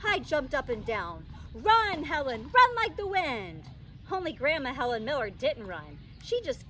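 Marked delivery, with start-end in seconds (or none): 1.5-3.6 urgent cry. A narrator's reading. Child urging grandma to flee.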